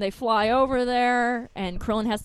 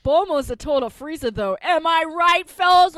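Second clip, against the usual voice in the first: mock bro banter; 5 semitones higher, 7 dB louder than usual. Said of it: "everyone's just like".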